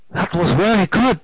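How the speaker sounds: put-on high German-accented praise. A narrator's reading. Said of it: "three months over from Germany".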